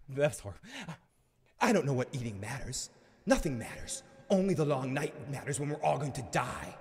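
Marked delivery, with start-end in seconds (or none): 1.6-6.7 a touch of hammed-up doom; softly, as if nothing matters; mock gloomy Bran. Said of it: "way too emotional".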